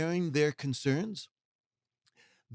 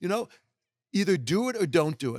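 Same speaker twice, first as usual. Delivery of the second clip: sharp, much higher mock retort; blunt shut up; 6 semitones higher, 4 dB louder than usual. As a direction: snap it like you're fed up with excuses. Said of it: "I tell people all the time, just, like".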